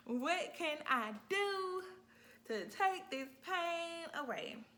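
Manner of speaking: high, loud, slow pleading to the nurse; labouring mother begging her nurse for relief, high and slow; desperate plea. Retold or imitated. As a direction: beg him, dragging every word. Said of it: "I'm like".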